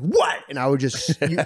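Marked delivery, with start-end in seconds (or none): 0.0-0.4 snapping what. Retold or imitated. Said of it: "and I'd be like".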